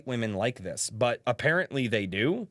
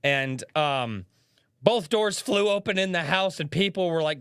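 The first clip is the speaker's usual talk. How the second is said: normal talking voice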